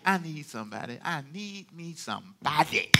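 soft, searching voice of God; musing search. Acted. God looking over the whole earth, soft musing. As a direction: drop the volume and ponder aloud.

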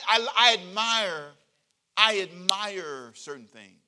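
slow and loud, leaning on admire; slow emphatic admire. Acted. Pastor telling the church what he admires, slow and emphatic. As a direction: draw it out, proud of it.

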